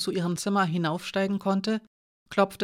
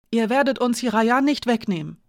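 put-on defiant fearful child; 5 semitones higher, 5 dB louder than usual. A narrator's reading. Janka defends Hiraya.

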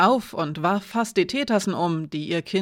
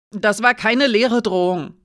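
sharp, louder snap of protest; flaring up; 3 semitones higher, 6 dB louder than usual. Acted. Alina snapping at Hiraya, flaring up. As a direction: bite back, the threat was real. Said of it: "fuhr Alina auf".

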